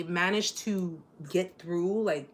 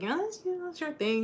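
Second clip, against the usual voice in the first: lightly voiced friend, much higher; quoted friend; 9 semitones higher, 6 dB quieter than usual. Friend bowing out behind the scenes, higher quoted voice. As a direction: quote her in a higher, softer voice. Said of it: "'cause she's like".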